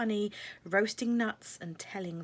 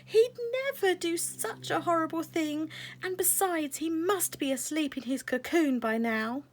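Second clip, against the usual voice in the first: upset protest; 10 semitones higher, 6 dB louder than usual. Acted. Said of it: "Butterfly Fairy cried".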